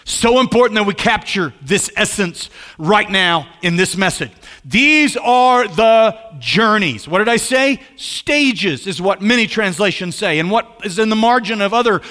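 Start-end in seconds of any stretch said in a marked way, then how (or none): 4.6-7.1 THESE are the journeys, each word slow and loud; preacher drilling a key phrase; slow emphatic. Shown as a demonstration. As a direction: lay it out slowly for the congregation to repeat. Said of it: "Can you repeat after me?".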